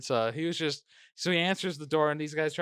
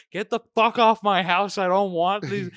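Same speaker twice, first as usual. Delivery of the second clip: retold yell; 5 semitones higher, 5 dB louder than usual. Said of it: "he yells at them".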